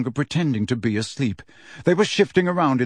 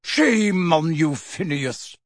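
scolding; 3 semitones higher, much slower than usual. Acted. Portrait scolding Phineas.

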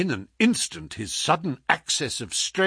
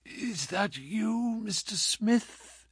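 soft, slow, higher sickbed voice; weak whisper; 8 semitones higher, 8 dB quieter, much slower than usual. A narrator's reading. Sick man greets his visitor, whispering weakly. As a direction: barely get the words out. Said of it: "Holmes whispered".

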